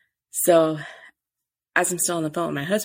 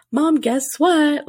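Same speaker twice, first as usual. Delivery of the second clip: much higher, louder and slow, hammed-up excitement; mock excited announcement; 11 semitones higher, 7 dB louder, much slower than usual. Daughter showing mom the test, mock excited. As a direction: sing out the big surprise she didn't do. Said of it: "I didn't do anything super special".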